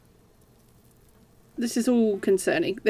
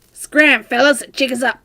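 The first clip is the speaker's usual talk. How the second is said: shooing; 4 semitones higher, 8 dB louder than usual. Acted. Shooing the bugs.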